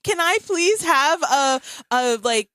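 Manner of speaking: laughing at herself even in her sleep; mock plea. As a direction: beg the dream gods, half laughing. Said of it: "It was like".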